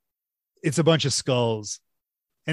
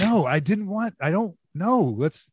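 deadpan Gene Wilder; 7 semitones higher than usual. Retold or imitated. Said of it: "weird deadpan".

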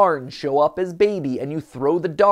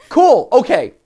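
excited; 10 semitones higher, 10 dB louder, slower than usual. Real, not acted. Reacting to new glitch.

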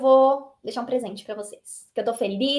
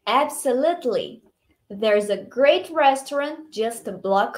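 slow, measured phrases with clear intonation; model dialogue reading; 3 semitones lower, much slower than usual. Shown as a demonstration. Teacher reading Alex's invitation for students to repeat, slow model reading. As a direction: say it slowly so learners can follow. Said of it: "tenta imitar a minha entonação".

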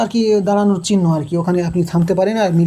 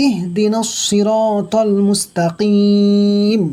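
slow melodic recitation; Quran recitation; much slower than usual. Shown as a demonstration. Teacher recites the verse after the tajweed rules, slow chant. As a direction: chant it with drawn-out vowels. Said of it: "এখানে ইয়া সাকিনের ডান দিকে জের এসছে".

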